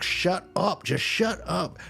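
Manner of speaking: retold yell at normal volume; mock angry outburst. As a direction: imitate someone fed up, pitch jumping up. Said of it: "making them more angry".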